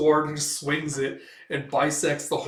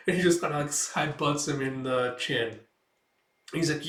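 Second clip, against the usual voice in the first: ordinary delivery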